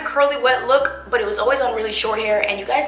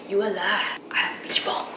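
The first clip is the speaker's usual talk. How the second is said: whisper; 4 semitones lower, 8 dB quieter than usual. Pretend. Joking about Bahamas look.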